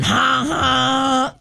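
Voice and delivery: high, drawn-out mock "ha, ha"; mocking laugh. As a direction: imitate passersby jeering with laughter. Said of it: "While people are going".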